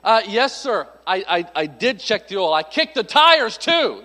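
hammed-up sarcasm, punching the last words; sarcastic. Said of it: "I wanted to be sarcastic".

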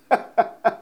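short airy laugh; breathy laugh. Real, not acted. Comic amused at the memory, airy laugh. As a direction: let out a quick breathy laugh.

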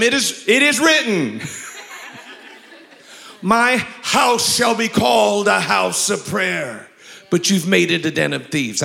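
fervent pulpit cry; preaching at full force. Acted. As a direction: thunder the scripture. Preaching the verse.

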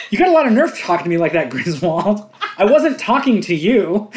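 loud, high put-down; mock-indignant movie quote. Griswold put-down to the neighbour, mock indignant. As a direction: fire back in mock indignation.

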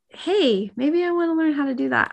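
put-on high, quick, eager offer to a child; high and fast, cheerfully pitching it. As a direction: brightly invite the kid to cook. Parent voiced offering to teach.

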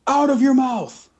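high, slow heckling yell; mock yell. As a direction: yell it from the crowd. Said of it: "I would have yelled out".

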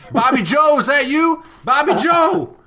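high, loud hillbilly calling out; hillbilly trucker. Retold or imitated. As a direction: yell for Bobby Joe like a hillbilly. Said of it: "come out around the corner and be like".

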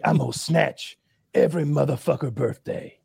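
lightly imitated mumbling action star; a hint of an action-star impression; Steven Seagal. Quoting the film's ridiculous line to friends, lightly imitated. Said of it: "says ridiculous things".

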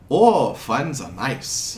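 put-on slow, drawn-out European accent; wandering foreign accent. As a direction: savor the words in a muddled foreign accent. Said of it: "his accent keeps jumping around a lot".

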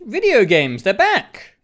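high, hyped-up reveal; excited. Acted. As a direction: sell it like big news. Hyped announcement of games' return.

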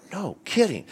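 high, soft mock wonder; retold amazement. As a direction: act out hushed, high-pitched disbelief. Retold amazed reply to Palmer.

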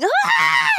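high shrieked "Ah!" imitating the actress; a short staged scream, high and sharp; mock scream. Retelling Satan screaming at God, high mock scream. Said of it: "screams at him".

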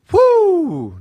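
sudden high cheer of victory; excited whoop. Real, not acted. Host cheering a win over opponents, excited whoop. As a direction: whoop it out like a celebration. Cheering that they are winning.

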